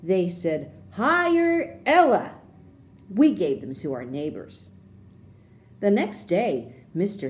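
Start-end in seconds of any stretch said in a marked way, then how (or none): 0.9-2.3 high, slow and bright, like a banner; proud child reading her poster out; poster slogan. A narrator's reading. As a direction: sell it like a big headline.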